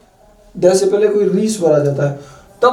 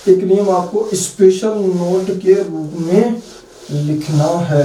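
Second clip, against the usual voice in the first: slow, spaced words for note-taking; slow teacher dictation; slower than usual. Shown as a demonstration. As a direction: read it out slowly so they can write. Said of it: "special note के रूप में लिखना है ना".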